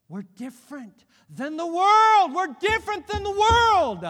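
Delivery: loud, high, slow preacher's cry; full-force preaching. Real, not acted. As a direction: thunder it out to the congregation, drawn out.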